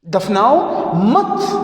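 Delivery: slow, stretched دفناؤ مت, rising on مت; دفناؤ مت as one slow phrase; مت joined to دفناؤ. Shown as a demonstration. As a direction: draw out دفناؤ and lift مت to show the misreading. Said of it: "یہ سمجھ جائے کہ".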